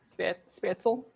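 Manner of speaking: Spätzle said slowly with a hint of a Russian accent; careful, quiet Spätzle with a Russian lilt. Shown as a demonstration. Trying out the German dish name, slight Russian accent. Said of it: "A little bit of a Russian accent there".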